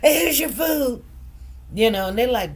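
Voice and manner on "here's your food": high, loud, fast tearful voice; tearful daughter. Retold or imitated. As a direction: blurt it out through tears. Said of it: "She like".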